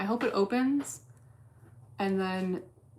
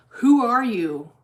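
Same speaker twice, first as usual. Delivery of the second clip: slow, drawn-out "Who are you?", higher and much louder; mock chatter voice; 4 semitones higher, 11 dB louder, slower than usual. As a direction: ham up the viewer's question. Reads chat message mockingly.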